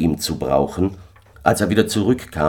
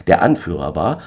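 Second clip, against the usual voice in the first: usual speaking voice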